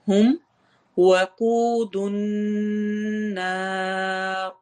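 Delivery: slow tajweed chant, vowels stretched; slow Quran recitation. Shown as a demonstration. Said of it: "واو مدہ ہوگی". (آہستہ تلاوت)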